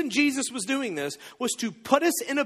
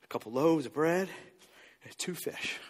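sheepish mumble; 6 semitones lower, 6 dB quieter than usual. Acted. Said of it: "he goes".

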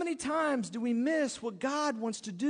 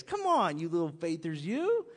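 smiling tease; 5 semitones lower than usual. Retold or imitated. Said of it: "He probably had a big smile on his face".

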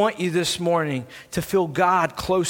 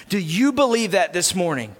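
ordinary talk, nothing marked

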